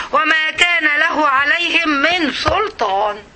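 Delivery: slow, chanted recital; Quran recitation. Acted. The teacher reciting the verse to her class, slow chant. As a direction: intone each word reverently.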